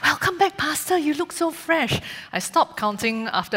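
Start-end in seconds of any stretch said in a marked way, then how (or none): 0.0-2.0 high mock-cheery congregant voice; imitating well-wishers. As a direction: chirp it like a friendly church auntie.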